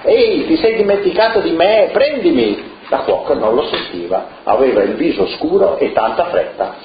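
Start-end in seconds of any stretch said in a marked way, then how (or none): 0.0-2.8 high little voice calling out, higher; a tiny salt jar crying out to be noticed; tiny voice shouting. A narrator's reading. The salt calls to the cook in a tiny high voice. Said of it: "gridava con la sua vocina".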